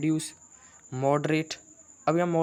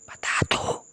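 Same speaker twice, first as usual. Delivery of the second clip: student whisper; 4 dB louder, much slower than usual. Pretend. Student voice asks quietly.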